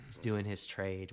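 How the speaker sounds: normal talking voice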